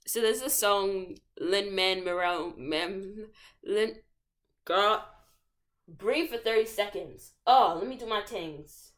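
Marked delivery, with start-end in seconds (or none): none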